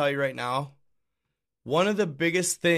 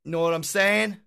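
normal talking voice

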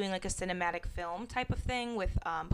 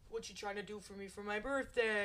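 brother's voice; 7 dB quieter than usual. Retold or imitated. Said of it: "he says to me".

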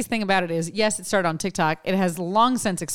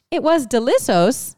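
TikTok narrator voice; 5 semitones higher, 3 dB louder than usual. Retold or imitated. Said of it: "and she goes".

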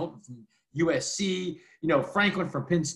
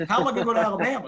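fast, higher, laughing through it; 7 semitones higher, 4 dB louder, much faster than usual. Retold or imitated. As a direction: laugh off the idea while saying it. Laughing quote to Dabo.

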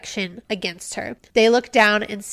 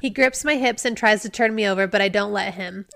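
ordinary delivery

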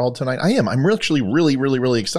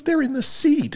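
whiny woman; 14 semitones higher than usual. Acted. Woman voiced in joke.

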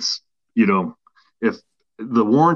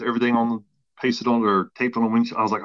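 ordinary talk

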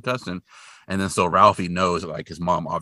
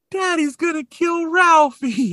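very high, slow mock wail; drawn-out high-pitched kid voice; crying little boy; 6 dB louder, slower than usual. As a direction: imitate a small child wailing, louder. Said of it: "and he's crying".

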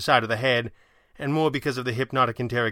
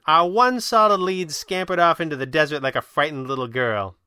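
higher, louder, disapproving question; frowning challenge; 6 semitones higher, 6 dB louder than usual. A narrator's reading. Kit quizzes the Red Panda about their escaped lead, frowning. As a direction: press him with a frown. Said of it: "she said, frowning again".

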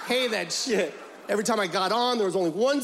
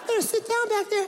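high mock driver's order, laughing; hammed-up high bus driver voice; 12 semitones higher than usual.

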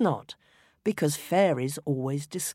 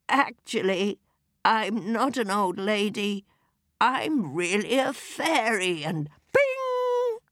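fairy voice; 5 semitones higher, slower than usual. A narrator's reading. Fairy reveal.

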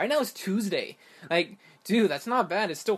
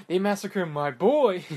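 slow, loud grieving mob boss; Godfather impression; 3 semitones higher, 6 dB louder, slower than usual. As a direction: wail it like an old don. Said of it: "bad, uh, Godfather impression".

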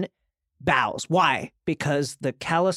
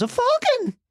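put-on high pampered child calling out to his uncle; Sweet Robin voice. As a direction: play a whiny little boy greeting his uncle. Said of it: "And Robin goes".

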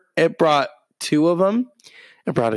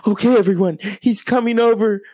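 high, louder flustered announcing; flustered announcement; 7 semitones higher, 4 dB louder than usual. Retold or imitated. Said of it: "She's getting a conniption fit".

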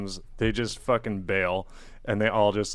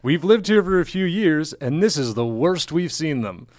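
raised, louder mock quote voice; a higher, louder take on her words; quoting a local woman; 6 semitones higher, 6 dB louder than usual. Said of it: "And she says".

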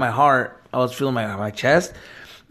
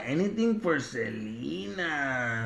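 quoting the movie line; 12 dB quieter, slower than usual. Retold or imitated. Quoting the famous Selena line.

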